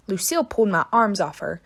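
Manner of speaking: ordinary delivery, nothing marked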